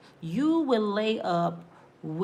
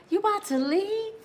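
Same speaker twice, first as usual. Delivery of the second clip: high woman voice; 10 semitones higher than usual. Retold or imitated. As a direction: ask it high and hurt.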